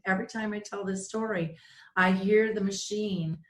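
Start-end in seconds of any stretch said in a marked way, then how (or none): none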